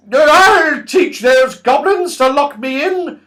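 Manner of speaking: put-on high, loud, slow furious old dame; old washerwoman shouting at the goblins; angry shout. A narrator's reading. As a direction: bellow it out, outraged at being locked in. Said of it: "she shouted".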